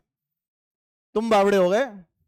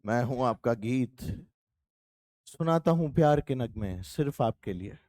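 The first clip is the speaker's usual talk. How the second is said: lower, softer dreamy radio host; teacher imitating a late-night radio jockey, lower and softer; 7 semitones lower, 5 dB quieter than usual. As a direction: croon it like the night-show presenter. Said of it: "रात को आता है ना वो वाला".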